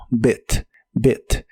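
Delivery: "bit" with a short, lax I, near "bet"; teacher's model of "bit", short vowel before the T. Shown as a demonstration. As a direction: say "bit" with a brief relaxed vowel. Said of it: "pero con la I corta".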